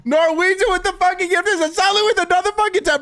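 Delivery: real hype shout; excited shout. Real, not acted. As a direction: shout the news to the whole chat. Announcing another ten gifts.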